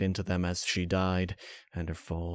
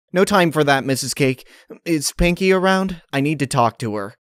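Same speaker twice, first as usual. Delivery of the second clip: higher, louder urgent tomboy; Rainbow Dash voice; 6 semitones higher, 5 dB louder than usual. Acted. Rainbow Dash urgently asking Mrs Cake about Pinkie, loud and hurried. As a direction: voice Rainbow, breathless hurry.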